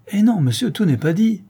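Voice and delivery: a sharp, gruff no, pitched high; impatient and gruff. A narrator's reading. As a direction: cut him off, impatient and gruff. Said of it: "d'un ton de plus en plus impatient et bourru".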